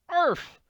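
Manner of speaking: high, loud yelped arf; dog bark. Acted. Sandy chases the cows away with a loud bark.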